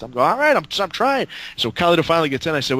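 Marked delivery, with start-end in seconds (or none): 0.3-1.3 high-pitched defensive protest; mock-flustered excuse; retold harried reply. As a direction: plead back, pitched well up. Retold harried reply to angry caller.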